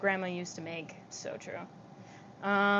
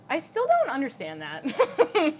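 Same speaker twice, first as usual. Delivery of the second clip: laughing; 6 semitones higher, 4 dB louder, slower than usual. Real, not acted. Laughing confusion.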